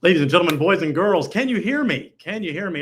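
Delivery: put-on loud, bright kids show host opener. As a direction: call out to the audience like a kids TV host. Host opening a live show.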